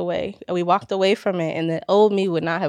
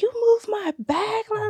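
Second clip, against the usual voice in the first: retold screaming; 13 semitones higher, 4 dB quieter than usual. Gym-goer imitating an angry woman accusing her over a bag, mock screaming. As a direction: imitate her screech without real volume.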